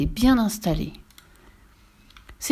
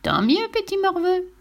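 high, taunting witch voice; spiteful witch, pitched high; gloating witch; 10 semitones higher than usual. Acted. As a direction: gloat at the suffering child.

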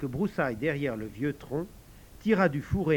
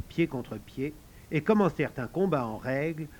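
ordinary delivery

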